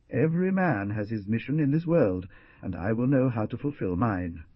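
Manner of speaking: plain reading voice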